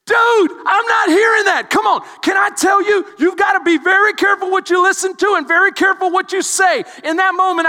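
high, loud urgent preaching; preaching at full force. Acted. Pastor warning the church to guard their words, shouting. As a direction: warn them hard.

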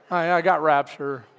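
low, dejected mock reply; reluctant, low-energy mumbled excuse; unenthused reluctance. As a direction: play it glum, like the rapture is a chore. Acting out reluctant response to rapture.

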